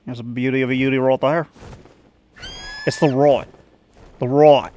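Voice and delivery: put-on Aussie accent; Australian accent. Rehearsing Aussie catchphrases, Australian accent. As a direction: lean into broad Australian vowels. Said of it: "like my Aussie".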